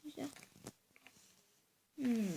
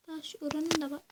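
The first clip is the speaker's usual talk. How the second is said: ordinary talk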